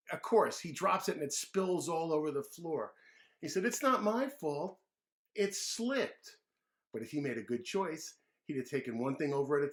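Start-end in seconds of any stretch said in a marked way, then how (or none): 3.7-6.4 put-on high, slow childish whine; dragged-out kid whine; whiny kid excuse. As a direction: blame the slippery glass. David spills juice.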